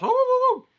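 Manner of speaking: woo woo woo, very high and loud whoops; excited whoops. Acted. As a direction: whoop like you can't contain yourself. Excited by imagined food.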